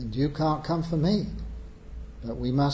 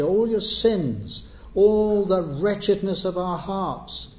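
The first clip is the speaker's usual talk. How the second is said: louder and higher, pressing each "all" home; preaching at full force; 4 semitones higher, 4 dB louder than usual. Acted. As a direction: build the plea like a pulpit appeal, hammering every all. Preacher urges sinners to come.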